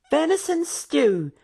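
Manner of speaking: high, slow housewife voice announcing dinner; announced. A narrator's reading. Wife announces dinner to guests, high and slow. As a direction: proudly present the stew, pitched high and slow. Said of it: "Missus Karina announced".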